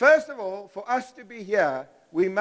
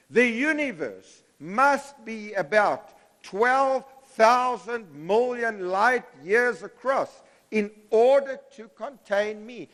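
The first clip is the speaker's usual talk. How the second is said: said slowly and evenly, a touch higher; slow recital; 4 semitones higher than usual. Shown as a demonstration. Professor leading students in a slow chant. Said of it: "Say it with me".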